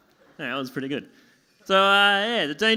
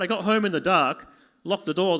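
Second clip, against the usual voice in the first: usual speaking voice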